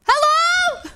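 searching shout; calling out. Real, not acted. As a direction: holler to see who is there. Calling out into the game.

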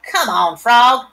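put-on loud boy's call. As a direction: call the frog loudly. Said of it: "cried Avery".

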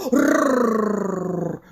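low dog growl rrrr. Acted. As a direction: growl again like a big dog. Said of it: "зарычал опять".

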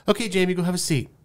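mock dismissive front-desk voice; imitated dismissive receptionist. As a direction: play the receptionist hurrying him along. Receptionist ignoring the correction.

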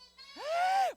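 high, loud gasp; mock shocked gasp. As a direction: gasp in exaggerated surprise at the children. Mock shock at children's quiet answer.

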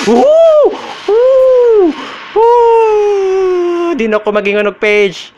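high, loud victory shrieks; shrieking jackpot winner. Real, not acted. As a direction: shriek like you hit the jackpot. Another page found.

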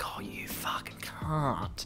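soft whispered Australian insult, fond; Australian accent, affectionate hushed whisper; Australian endearment. Shown as a demonstration. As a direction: breathe it like an Australian term of endearment. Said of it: "But in an Australian accent, in terms of endearment".

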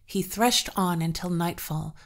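ordinary delivery, nothing marked

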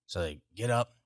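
normal talking voice

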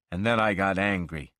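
ordinary delivery

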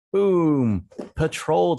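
bright loud boom to kick off; excited boom. Real, not acted. Teacher kicks off reading time with an excited boom. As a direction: punch it out like a show host.

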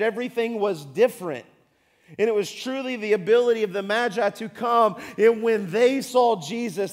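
loud, full-force sermon delivery; preaching. Acted. Pastor preaching hard to the congregation. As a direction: drive it home from the pulpit.

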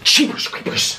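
mock cartoon gasp. Mock exclamation at food.

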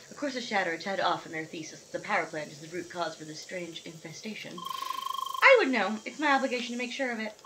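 matter-of-fact scientist. Acted. Scientist explaining the power plant thesis, matter-of-fact. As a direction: read it out as a factual finding. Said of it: "matter of fact scientist lady".

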